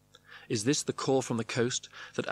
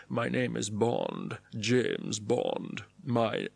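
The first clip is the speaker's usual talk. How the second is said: James Bond; much slower than usual. A narrator's reading. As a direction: intone it slowly like a screen spy. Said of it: "intoning a silent mantra".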